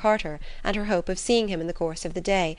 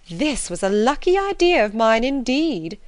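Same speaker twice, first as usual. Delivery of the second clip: louder, higher smug gloat; self-satisfied mother; 5 semitones higher, 6 dB louder than usual. A narrator's reading. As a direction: crow over her own cleverness. Mrs Bennet gloats over rain.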